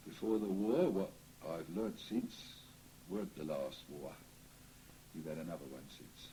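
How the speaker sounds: ordinary talk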